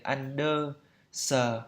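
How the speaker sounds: understand split slowly into "un-do... suh"; slow, unstressed Vietnamese syllables in understand; Vietnamese-style mispronunciation. Shown as a demonstration. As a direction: chop understand into separate even syllables, extra vowel after S. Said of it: "kiểu kiểu thế".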